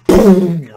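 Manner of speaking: shouted explosion noise; loud boom. Retold or imitated. Fan imitating the reject dial blast.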